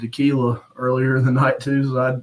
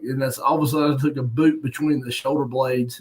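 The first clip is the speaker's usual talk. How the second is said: ordinary delivery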